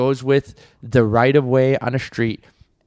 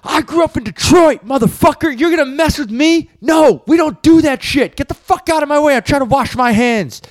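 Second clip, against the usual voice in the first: high, loud shouted threats; furious shouting man; 12 semitones higher, 4 dB louder than usual. Retold or imitated. The old man to the kid with the camera, a high furious shout. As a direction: yell him down in the restroom. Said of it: "dude goes".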